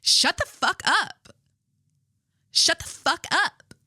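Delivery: high, slow sassy snipe; a sassy high-pitched imitation; mocking Abel. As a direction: mimic a prissy sassy snap. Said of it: "here's how Abel was".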